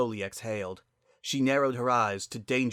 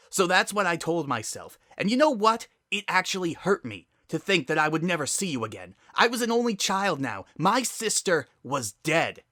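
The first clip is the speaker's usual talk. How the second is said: raised, higher hurt anger; angry; 8 semitones higher, 6 dB louder than usual. Acted. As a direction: fight back tears with rising fury. Sister's angry confrontation.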